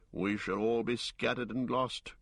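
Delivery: ordinary delivery, nothing marked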